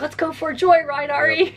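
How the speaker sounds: high, loud excited dog voice; dog's voice. Retold or imitated. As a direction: speak for the dog, eager to go. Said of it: "He's like".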